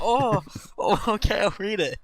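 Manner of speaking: high, squeaky startled yelp, then meek giving in; mock startled compliance. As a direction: squeal it like someone just smacked on the head. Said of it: "I was like".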